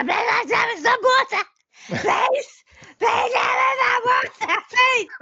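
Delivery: high, loud, pleading cry for water; desperate begging. Retold or imitated. To the waitress, begging desperately for water. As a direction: beg like you're dying of thirst.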